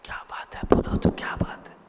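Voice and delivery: hushed whisper, barely voiced. Man whispering to Krishna, secret whisper. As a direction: lean in and whisper the question. Secret talk between two.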